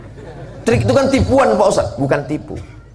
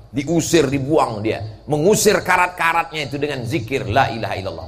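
usual speaking voice

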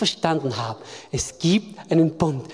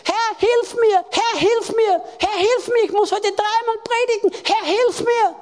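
much higher, pleading prayer, retold; desperate prayer; 13 semitones higher, 3 dB louder than usual. Preacher reenacting his stressed prayer before preaching, pleading. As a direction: imitate his old anxious begging. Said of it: "habe folgendes Gebet gebetet".

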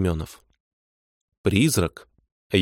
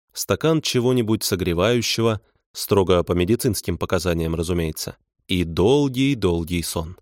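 plain reading voice

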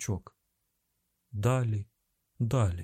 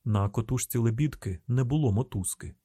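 usual speaking voice

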